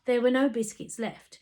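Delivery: ordinary delivery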